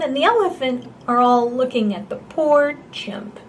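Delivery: usual speaking voice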